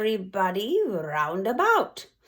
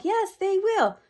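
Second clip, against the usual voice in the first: audience crying out; 11 semitones higher than usual. Acted. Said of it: "The audience cried".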